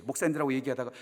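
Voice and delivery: ordinary delivery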